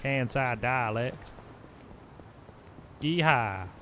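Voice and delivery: slow Southern US twang on Japanese; mock Southern accent, vowels stretched; heavily Southern Japanese. Shown as a demonstration. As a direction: drawl it out like a Southerner speaking Japanese. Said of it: "Being heavily southern in them".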